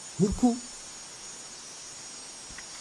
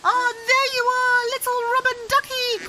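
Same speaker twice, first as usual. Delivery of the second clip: delighted discovery. Real, not acted. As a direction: greet the duck with delight. Finding the toy duck.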